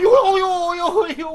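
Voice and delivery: high crying yelps of pain; pained wail. Acted. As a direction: whimper and yelp at each hit. Servant wails under the blows.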